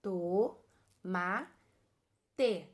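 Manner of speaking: each syllable on its own, pauses between. Shown as a demonstration. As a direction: break TOMATE into To, Ma, Te. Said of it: "eu formo três som".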